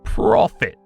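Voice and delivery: ordinary delivery, nothing marked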